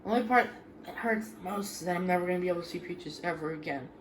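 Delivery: fighting tears, barely above a murmur; holding back tears. Real, not acted. Kid mourning the family cat Peaches, quiet and tearful. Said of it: "I'm trying not to cry again".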